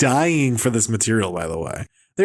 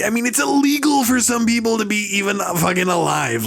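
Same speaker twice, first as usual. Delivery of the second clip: high-pitched mock-outraged rant; Marc Maron; 8 semitones higher than usual. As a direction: whine in exaggerated outrage. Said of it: "He's like".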